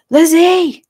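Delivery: loud, high-pitched yell of a name; urgent shout. A narrator's reading. Arriving officer searching for Lizzie, urgent shout. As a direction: shout her name across the house, falling at the end. Rescuer calls out louder, searching.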